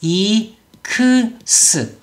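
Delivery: 라이크스 broken slowly into four syllables. Shown as a demonstration. Teacher breaks down the common mistake, syllable by syllable. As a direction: spell out 라-이-크-스 with full vowels. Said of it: "이렇게 발음하는 경우가 아주 많아요".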